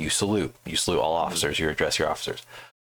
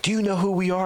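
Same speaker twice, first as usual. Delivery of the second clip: indignant officer; 12 semitones higher than usual. Retold or imitated. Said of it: "they're like".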